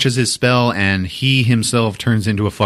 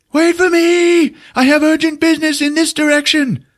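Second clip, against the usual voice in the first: pitched-up fleeing cry; panicked wizard; 3 dB louder than usual. Retold or imitated. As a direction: yell after them in hammed-up fear. Wizard runs from cyclops.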